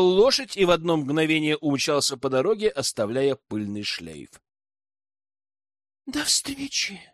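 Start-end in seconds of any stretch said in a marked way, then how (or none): 6.1-7.0 high, soft, sighing goodbye; wistful sigh. A narrator's reading. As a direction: sigh it after him, high and quiet. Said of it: "вздохнула Аманда".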